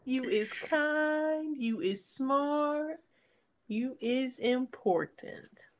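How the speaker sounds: high, slow, soft pet-talk quote; dog voice. Acted. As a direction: coo each line slowly to the dog. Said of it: "My dog voice".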